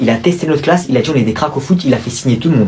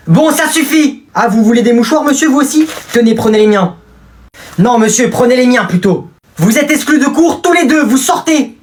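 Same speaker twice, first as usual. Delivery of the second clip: stern teacher; 9 semitones higher than usual. Acted. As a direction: lay down the law. Teacher throws pupils out.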